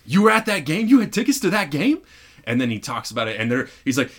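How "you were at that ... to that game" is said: high, loud disbelieving outburst; imitated excited shout; incredulous excitement. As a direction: blurt it out in acted amazement. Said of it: "or Will, it says".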